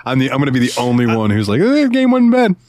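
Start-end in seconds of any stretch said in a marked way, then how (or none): 1.6-2.6 much higher and louder, chipper; mock easygoing shrug. Picturing himself as the lone positive one, chipper and high. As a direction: toss it off cheerfully. Imagined own upbeat remark.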